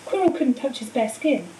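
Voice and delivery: ordinary delivery, nothing marked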